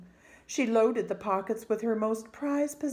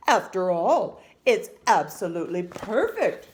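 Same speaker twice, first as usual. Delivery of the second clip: louder father listing it off; indignant father; 9 dB louder than usual. A narrator's reading. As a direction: pile the words on like a proud dad. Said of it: "said her father".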